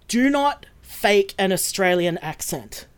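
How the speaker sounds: slow, deliberate warning, every word spaced; big-letters emphasis. Retold or imitated. Producer warning actors on a casting call, emphatic. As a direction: spell it out slowly like capital letters. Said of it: "this was in big letters".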